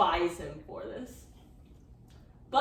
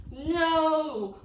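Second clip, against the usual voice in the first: villain cry; 7 semitones higher, 4 dB louder than usual. Acted. Villain foiled in skit.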